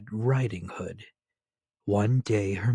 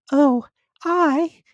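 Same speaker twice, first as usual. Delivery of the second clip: put-on much higher, louder child voice; little girl voice; 10 dB louder than usual. A narrator's reading. Little girl answering the wolf, high and childlike. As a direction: pipe it up like a small girl.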